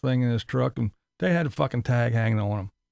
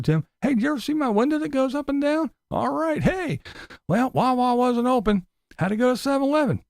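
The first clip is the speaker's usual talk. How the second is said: much higher, goofy rambling mock voice; mocking Tim; 11 semitones higher than usual. Mocking Tim rambling about coffee and his truck. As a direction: parody an endlessly repeating vlogger. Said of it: "That's what he does".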